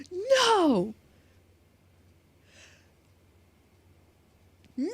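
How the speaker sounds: high, drawn-out dramatic "no"; mock anguished cry. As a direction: wail in hammed-up despair. Mourns lost ice cream.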